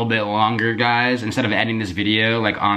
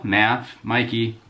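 usual speaking voice

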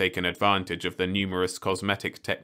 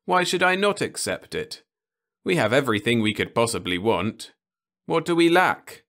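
higher, louder puzzled youth; earnest young man; 5 semitones higher, 5 dB louder than usual. Acted. Ludovic asks Osmond what they lack, earnest and higher. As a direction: protest with innocent conviction. Ludovic defends his life.

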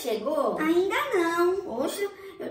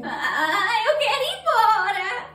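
put-on higher, sobbing kid's plea to leave; crying whine; 5 semitones higher, slower than usual. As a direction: whimper it like a scared child. Kid crying at the clinic. (choro manhoso de criança)

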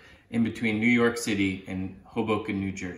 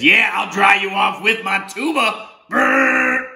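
higher, loud proud shout with a tuba blast; gleeful octopus; 8 semitones higher, 5 dB louder, slower than usual. Acted. Octopus drying the boy with a tuba, loud and high. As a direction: boast it then blow a brassy brrr. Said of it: "the octopus says".

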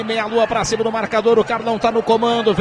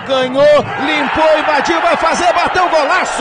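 explosive goal commentary; 5 semitones higher, 6 dB louder than usual. Real, not acted. Build to goal.

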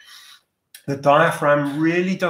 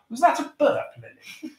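amused surprise; 11 semitones higher than usual. Real, not acted. Teacher hears a student burp.

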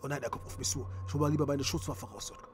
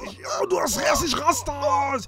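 much louder and higher angry shouting; furious rant; 9 semitones higher, 6 dB louder than usual. Acted. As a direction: explode at the traitor. Gang boss explodes in rage.

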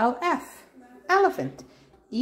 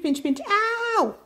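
high-pitched mock yelp; pretend ow; 12 semitones higher than usual. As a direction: squeal a playful ow at the pinch. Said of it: "you'll say ow".

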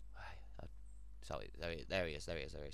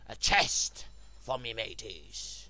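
slow, loud hammed-up pirate; mock pirate announcing treasure; 8 dB louder, slower than usual. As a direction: growl it like a pirate captain. Pirate voice over found chest.

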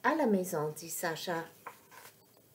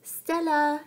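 raised, higher call; 7 semitones higher, 4 dB louder than usual. A narrator's reading. Sacha looking for hidden Stella, calling out. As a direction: call out for a friend out of sight. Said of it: "crie Sacha".

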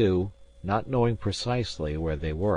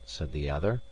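ordinary delivery, nothing marked

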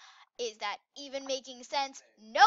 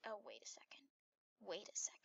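whispered realization; 4 semitones lower, 16 dB quieter, faster than usual. Real, not acted. Realizing she is a fox.

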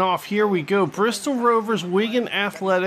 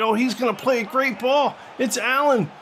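excited commentary climbing higher and higher; building goal call; 6 semitones higher than usual. Real, not acted. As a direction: build from tense anticipation to a high goal cry.

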